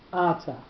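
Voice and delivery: ata stressed as A-ta; stress on first syllable. Shown as a demonstration. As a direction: lean on the opening A of ata. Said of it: "the accent on the first syllable".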